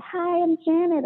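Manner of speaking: high, slow, breathy-sexy phone greeting. Shown as a demonstration. Phone sex worker greeting a caller, put-on sexy voice. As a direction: purr a slow come-on greeting. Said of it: "I put the sexy voice on".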